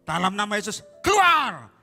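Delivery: high, forceful bellow; loud exorcism shout. Retold or imitated. Preacher re-enacting exorcism in an empty food stall, shouting. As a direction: shout the command at full voice. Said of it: "saya kan kenceng".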